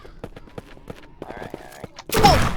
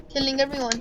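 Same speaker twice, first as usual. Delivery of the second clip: usual speaking voice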